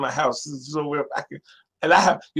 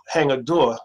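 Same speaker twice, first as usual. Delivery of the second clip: usual speaking voice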